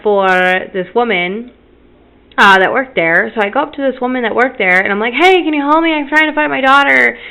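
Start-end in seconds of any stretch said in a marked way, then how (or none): 5.1-7.2 higher retold request for help; retold anxious appeal; urgent plea. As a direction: plead for help finding your child. Retold plea to store worker.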